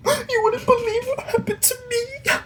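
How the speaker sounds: high mock crying whine; sobbing on the phone. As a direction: blubber it into the phone. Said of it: "he's like".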